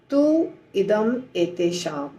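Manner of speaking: तू इदम एतेषाम slowly, word by word; slow careful split. Shown as a demonstration. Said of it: "मुश्किल शब्द आ रहा है". (धीरे-धीरे अलग-अलग शब्द)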